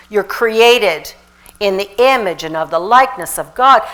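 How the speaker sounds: loud, rousing sermon voice; loud, high-energy pulpit delivery; full-force preaching. Real, not acted. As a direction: preach it to the crowd.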